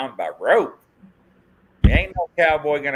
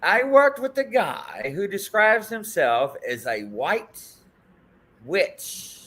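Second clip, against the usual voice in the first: reading a viewer comment; 6 semitones higher than usual. Retold or imitated. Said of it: "it says".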